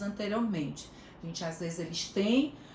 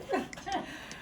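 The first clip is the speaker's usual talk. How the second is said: laugh; 11 semitones higher, 6 dB quieter than usual. Real, not acted. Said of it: "Bem areada".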